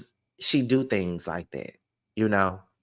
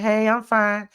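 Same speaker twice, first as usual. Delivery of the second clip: sweet reply; 11 semitones higher, 5 dB louder, slower than usual. Retold or imitated. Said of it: "She's like".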